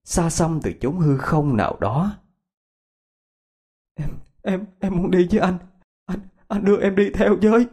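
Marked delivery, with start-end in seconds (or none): 4.0-7.7 weeping. Acted. Grieving girl asking her dead lover why he left, tearful. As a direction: beg him through tears in a high, broken voice. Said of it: "Cô khóc không thành tiếng".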